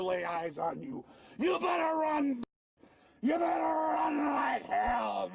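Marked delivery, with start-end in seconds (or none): 1.4-5.3 threatening shout. Real, not acted. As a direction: scream the threat. Arrestee screaming threat.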